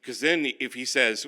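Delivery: ordinary delivery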